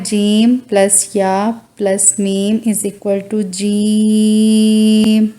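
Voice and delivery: slow, spelled-out explanation; slow explaining. Shown as a demonstration. Teacher slowly builds the letter name from its parts. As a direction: lay out each part slowly. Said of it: "तीन second इतना लंबा करना है".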